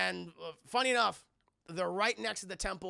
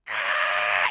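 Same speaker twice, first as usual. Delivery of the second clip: loud, low drawn-out mock scream; 11 semitones lower, 12 dB louder than usual. Imitating Sindel unleashing her power, in a loud mock scream. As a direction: hold a long, hammed-up "aah". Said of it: "she just starts screaming".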